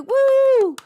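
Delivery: high, loud burst of a cheer; excited whoop. Real, not acted. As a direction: let out a delighted woo. Cheering over a good date.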